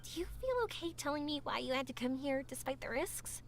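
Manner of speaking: ordinary talk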